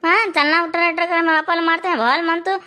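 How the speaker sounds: put-on bully him back like a tough girl; tough girl's threat. Girl friend threatening the boy on Babli's behalf, high and bossy.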